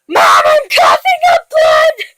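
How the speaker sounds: high, drawn-out shout for mom; hammed-up alarm, shrieked high; panicked cry. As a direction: yell it like a panicking kid. Reads child's panicked cry to mom.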